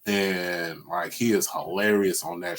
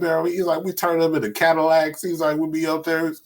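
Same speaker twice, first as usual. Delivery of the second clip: higher, lively comic bit voice; imitating Steve Harvey; 6 semitones higher than usual. Retelling spaceship joke to friend, lightly voiced comedian. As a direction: play the hype bragging comedian.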